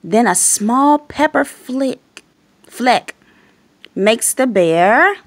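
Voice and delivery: put-on lively read-aloud voice for little kids; storybook reading. A narrator's reading. Reading a picture book to young viewers, lively. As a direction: read it like bedtime story time.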